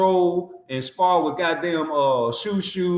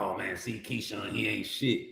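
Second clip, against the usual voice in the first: mimicking the critics; 11 semitones lower, 11 dB quieter than usual. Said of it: "Everybody in the world talking about".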